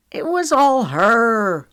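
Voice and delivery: high, loud, drawn-out denial; defensive retort. A narrator's reading. Wiley answers Trent's accusation, defensive. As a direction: shoot the blame back. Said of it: "Wiley shot back".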